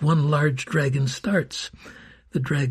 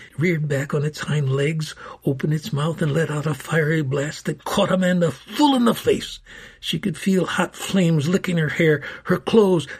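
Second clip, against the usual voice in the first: ordinary delivery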